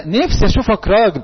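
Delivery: a hint of a parent lamenting to their son; nagging parent. Parent wishing the son were a man, mildly nagging. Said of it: "طيب بابا وماما بقى".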